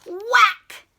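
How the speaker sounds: high, loud sound word; loud whack. A narrator's reading. Reading the home-run hit aloud to children, loud and high. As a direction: punch out the bat's crack.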